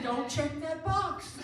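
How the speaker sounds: high, loud, slow comic warning; mock warning. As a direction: retell it as an emphatic, drawn-out warning. Acted comic warning to the man.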